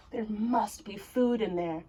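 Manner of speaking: hushed whisper to himself. Acted. Hungry Jack sneaking into the castle, whispered thought. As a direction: breathe the words, no voice.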